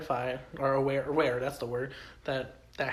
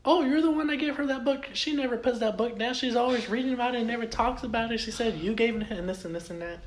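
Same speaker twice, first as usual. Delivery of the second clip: high, excited husband gushing; husband's voice; 8 semitones higher, 3 dB louder than usual. Retold or imitated. Husband tells Tony about the book, high and eager. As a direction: play the eager husband, pitched way up. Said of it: "He's like".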